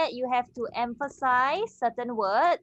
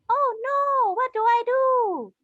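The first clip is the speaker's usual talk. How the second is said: put-on much higher and louder, a panicked girl; Sally's voice; 12 semitones higher, 5 dB louder than usual. Teacher voices Sally in a dialogue, high and panicked. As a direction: squeak it out in a worried girl's voice. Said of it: "change your pitch a bit higher".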